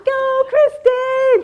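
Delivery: slow, high, drawn-out cheer; cheering yell. Retold or imitated. Daughter cheers her mother down the slope, loud and slow. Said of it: "I could hear my daughter yelling".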